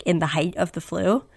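usual speaking voice

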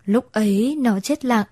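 ordinary delivery

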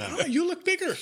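a hint of a surprised coach; imitating the coach. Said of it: "And you went".

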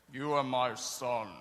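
low, slow booming God voice; deep, slow divine voice, self-mocking. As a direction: boom it down from the clouds, slowly. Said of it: "That's probably a terrible, not even close to how it sounded".

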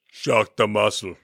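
slow, bad Austrian accent; amateur attempt at an Austrian strongman accent; Arnold Schwarzenegger impression. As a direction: do a clumsy Austrian accent, drawn out. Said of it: "my accent is horrible".

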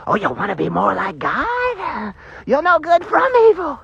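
put-on voice leaping high at the end; hammed-up coaxing impression, climbing in pitch; mock tempter voice. Preacher imitating the temptation to be like God, hammed-up voice. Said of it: "I can already hear 'em".

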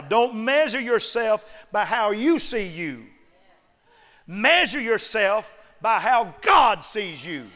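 slow, high, loud building preacher shout; preaching at full force. Acted. Preacher rallying the congregation with full force.

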